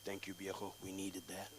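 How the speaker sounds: quiet, understated deadpan; hushed, dry thanks; low-key uncle. Retold or imitated. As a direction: say it softly, eye to eye. Uncle's solemn reply at funeral.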